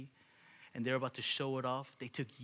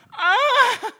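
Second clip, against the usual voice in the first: high, loud comic shriek; hammed-up scream; 20 dB louder than usual. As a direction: let out an over-the-top yell. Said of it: "He probably didn't say it like that".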